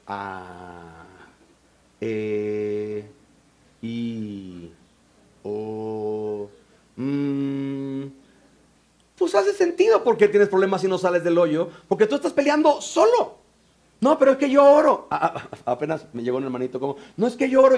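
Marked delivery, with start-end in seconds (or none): 0.1-8.0 low, soft, drawn-out monotone hums; hesitant stalling vowels. Retold or imitated. Church member asked what God said, stalling. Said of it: "Empiezan con las vocales cristianas". 14.0-15.1 high, insistent mock protest; defensive protest. Church member defending prayer life to pastor, defensive. 17.2-17.8 high-pitched mock insistence; defensive protest. Hermanito arriving for counsel, defensive. Acts the member protesting again.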